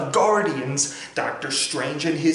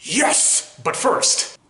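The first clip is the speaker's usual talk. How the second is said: excited; 7 semitones higher, much slower than usual. Acted. Thor celebrates.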